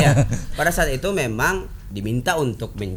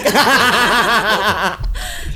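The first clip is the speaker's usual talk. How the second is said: laugh; 6 semitones higher, 5 dB louder than usual. Real, not acted. Group laughter.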